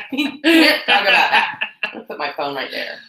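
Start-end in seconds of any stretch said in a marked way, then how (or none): 0.1-1.6 slow, through laughter; cracking up while refusing; laughing. Real, not acted. Refusing to discuss grapefruit video.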